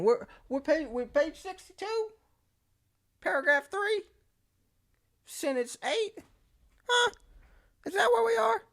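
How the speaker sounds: high, breathy-light confused questions; flustered lost kid. Retold or imitated. Lost student asking classmates what page, high flustered voice. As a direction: sound like a kid lost in the book.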